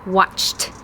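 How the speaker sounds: "watched" ending in a crisp t. Shown as a demonstration. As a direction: finish "watched" with a voiceless t. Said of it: "The T sound".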